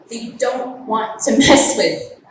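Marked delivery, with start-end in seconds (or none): none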